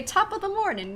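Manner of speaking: high, mock-Irish greeting; silly Irish accent. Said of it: "with a silly accent says it".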